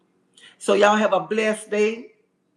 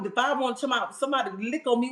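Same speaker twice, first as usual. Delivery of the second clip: usual speaking voice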